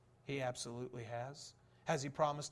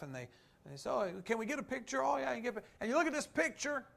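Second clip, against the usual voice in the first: higher and louder, quick and animated; excited storytelling; 7 semitones higher, 6 dB louder, faster than usual. Acted. Preacher picturing fans meeting a celebrity, excited. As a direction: paint the thrill of meeting a star.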